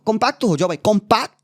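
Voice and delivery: exasperated plea. Real, not acted. Fan begging the team to stay compact, exasperated. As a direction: push the word up in exasperation.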